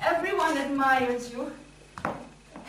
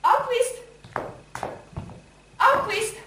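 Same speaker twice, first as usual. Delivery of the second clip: high, loud hail; calling out; 8 semitones higher, 3 dB louder than usual. Acted. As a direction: shout a name to someone below. Calling a man up from outside.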